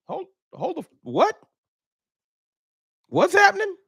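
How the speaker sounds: high-pitched startled double take; shocked disbelief. Pretend. Dak hearing the Cowboys drafted a quarterback, shocked squeak. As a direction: jolt upright like the ground moved.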